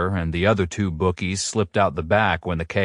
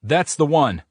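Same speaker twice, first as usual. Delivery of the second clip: mild yell; 7 semitones higher than usual. A narrator's reading. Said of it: "the government agent yells".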